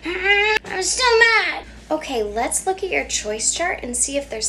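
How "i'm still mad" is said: higher, louder, slow crying whine; upset child whine. Acted. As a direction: whine like a cross toddler.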